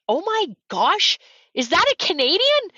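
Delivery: amazed onlooker. Retold or imitated. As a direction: imitate a stunned spectator spotting someone, high-pitched. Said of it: "all I heard was".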